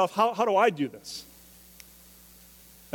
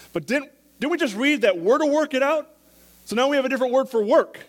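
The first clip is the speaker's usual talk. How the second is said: loud, high preaching, driving the point home; preaching at full force; 9 semitones higher, 7 dB louder than usual. Acted.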